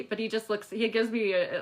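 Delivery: ordinary talk, nothing marked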